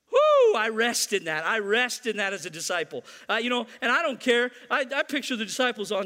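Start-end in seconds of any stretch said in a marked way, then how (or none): 0.1-0.5 high loud celebratory whoop; joyful whoop. Real, not acted. Preacher whooping in delight at his own point. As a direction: let out a whoop of joy.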